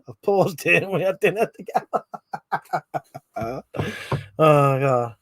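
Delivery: laughing. Real, not acted. Laughs reading viewer comment.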